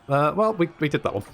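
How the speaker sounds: ordinary delivery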